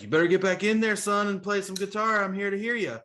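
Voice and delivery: put-on high voice, firmly encouraging. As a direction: imitate a bluesman ordering a youngster back inside. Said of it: "Muddy comes down here".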